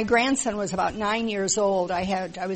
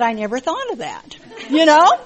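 laughing retell; 7 semitones higher, 12 dB louder than usual. Retold or imitated. Retold grandson reply, laughing.

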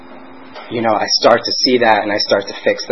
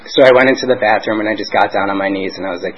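usual speaking voice

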